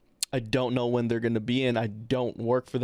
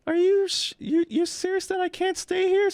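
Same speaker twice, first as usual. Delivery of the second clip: much higher, louder wounded plea; imitating hurt disbelief; 4 dB louder than usual. Returning visitor asking if she truly must leave, mock hurt disbelief. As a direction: mimic her as hurt and incredulous. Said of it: "she got hurt".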